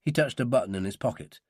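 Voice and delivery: ordinary delivery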